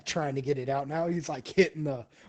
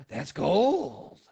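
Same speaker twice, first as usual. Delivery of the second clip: greedy exclaim; 4 semitones higher, 3 dB louder than usual. Retold or imitated. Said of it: "and he goes".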